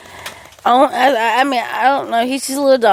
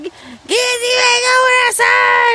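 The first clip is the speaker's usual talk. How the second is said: Cheyenne; 10 semitones higher than usual. Retold or imitated. Cheyenne screaming at the dog on its return, imitated high holler. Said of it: "She screams all the time".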